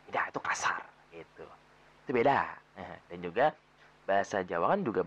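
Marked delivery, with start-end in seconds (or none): 0.1-2.4 whispered aside; hushed whisper. Real, not acted. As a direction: whisper "beda, itu kasar gitu" as if it's rude.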